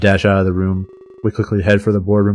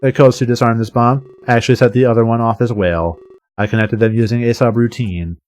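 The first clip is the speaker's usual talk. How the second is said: ordinary delivery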